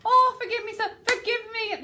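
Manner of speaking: high, loud imploring cry; pleading character. Shown as a demonstration. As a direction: beg dramatically, as if praying. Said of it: "arms as prayer".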